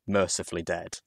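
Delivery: ordinary delivery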